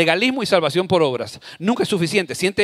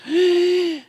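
high tearful gasp; mock sob from the wife; sobbing gasp; 11 semitones higher than usual. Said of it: "vino llorando".

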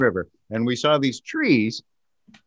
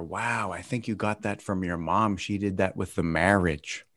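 softer, muted aside; said in his head; 4 semitones lower, 8 dB quieter than usual. Acted. Dad privately comments on daughter, soft inner-thought voice. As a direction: keep it down, like an unspoken thought. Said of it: "That's what I said in my head".

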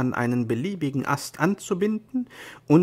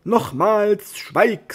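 much higher, louder shout; angry shout; 8 semitones higher, 7 dB louder, much slower than usual. Acted. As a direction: snap the order at full voice. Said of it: "Don Quixote wurde ernstlich böse".

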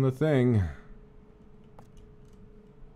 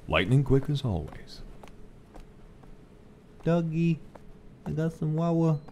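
usual speaking voice

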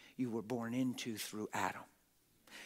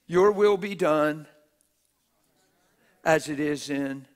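loud prayer; 2 semitones higher, 15 dB louder than usual. Real, not acted. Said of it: "Why is that his prayer?".

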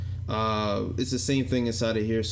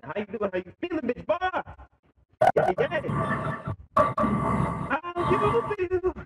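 chipmunk voice. Shown as a demonstration. Voice changer preset demo.

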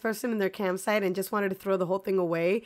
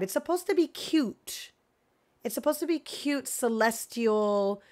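ordinary delivery

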